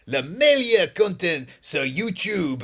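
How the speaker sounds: slow, emphatic French presenter voice; Benjamin Bronto. Retold or imitated. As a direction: draw out each French word like a video host. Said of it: "he speaks like that".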